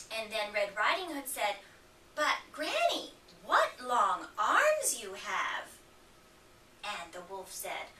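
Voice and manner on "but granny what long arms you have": put-on curious little girl. Red questions the disguised wolf.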